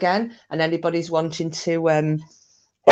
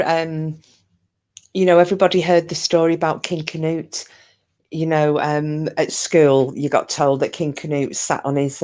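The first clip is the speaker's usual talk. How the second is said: ordinary talk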